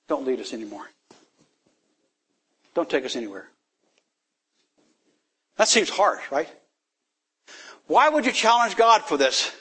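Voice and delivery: normal talking voice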